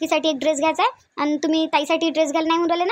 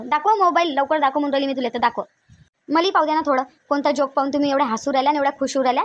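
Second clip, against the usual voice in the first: ordinary delivery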